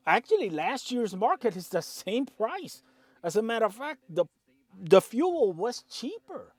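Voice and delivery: getting heated about the numbers; worked up, pushing the words out; indignant trucker venting about prices; fired up. Real, not acted. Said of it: "I'm getting fired up with this".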